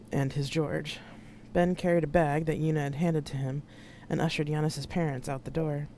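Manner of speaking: ordinary delivery, nothing marked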